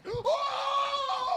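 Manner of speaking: very high, loud laughing shriek; a gasp that breaks into a laughing scream; shrill laughing gasp. Real, not acted. Shocked reaction in game.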